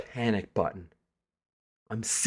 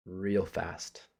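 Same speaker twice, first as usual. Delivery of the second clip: ordinary talk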